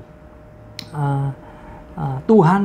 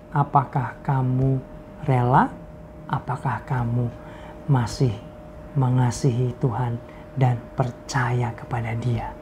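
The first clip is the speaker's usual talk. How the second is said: softer, whispered searching question; hushed challenge; 4 semitones lower, 5 dB quieter than usual. Retold or imitated. Pastor's chat asks the friend if he still trusts God, whispered.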